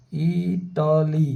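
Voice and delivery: इटाली stretched slowly, each syllable held long; slowly drawn out. Shown as a demonstration. Teacher to students, sounding out the word very slowly. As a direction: spell out इटाली slowly for the class. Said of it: "इसी तरह इटली".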